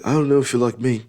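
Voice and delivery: a hint of a posh foreign accent, toned down; generic posh non-American accent. Vlogger imitating a boxer on YouTube in a mild posh non-American accent. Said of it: "That's not his accent".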